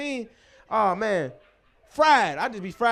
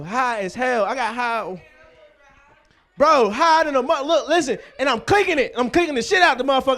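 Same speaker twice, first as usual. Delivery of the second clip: normal talking voice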